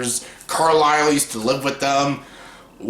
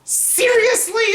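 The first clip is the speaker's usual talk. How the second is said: seriously jumping way up high; incredulous squeal; slower than usual. Retold or imitated. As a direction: squeak it out in disbelief. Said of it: "I'm like".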